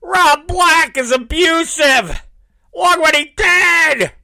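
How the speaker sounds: high, loud mock accusation; mock outraged shout. Mocking an ex shouting abuse claims to the world, high outraged yell.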